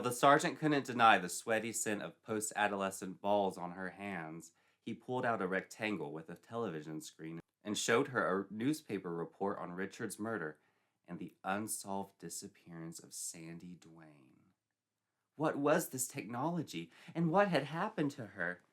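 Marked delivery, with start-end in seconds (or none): none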